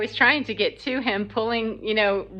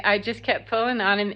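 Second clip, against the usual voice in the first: ordinary talk, nothing marked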